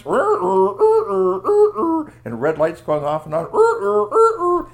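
very high, loud siren rrr; siren wail. Acted. Narrator imitating palace sirens, very high and loud.

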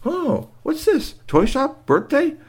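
put-on very high, slow, wondering child voices; wide-eyed little kids asking; curious kids. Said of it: "And the kids were like".

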